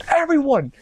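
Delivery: very high, squeaky robber cry; mock gunman yell. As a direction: squeal it like a cartoon stick-up man. Voices gunman storming the store.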